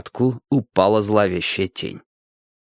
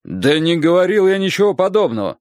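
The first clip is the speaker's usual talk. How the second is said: higher, indignant protest; insistent denial; 6 semitones higher than usual. A narrator's reading. Director denying the teacher's claim, insistent. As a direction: push back firmly against the accusation. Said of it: "настаивал директор".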